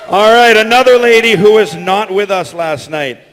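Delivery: normal talking voice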